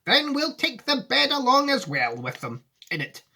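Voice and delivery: high, earnest Wonka voice; serious Wonka. Acted. Wonka answering Charlie seriously, in a high voice.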